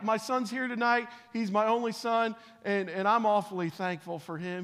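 usual speaking voice

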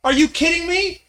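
slow, incredulous exclamation; amazed disbelief. Real, not acted. Preacher marvels at scared disciples.

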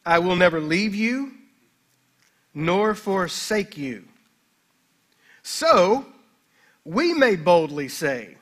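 slow, weighty reading with long pauses; solemn scripture reading. Acted. Pastor reading the Hebrews text to the congregation, slow and solemn. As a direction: let each phrase of the verse land.

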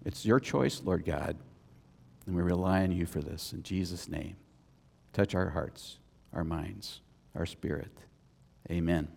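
soft, low closing prayer; hushed low prayer voice to God. Acted. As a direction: pray it quietly and reverently.

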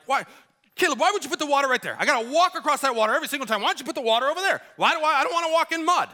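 high, fast, exasperated dad rant; re-enacted yelling at kids. Crabby dad yelling at son over water, exasperated. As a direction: rattle off complaints in a high-pitched crabby tirade. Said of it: "I'm, like, yelling at my kids".

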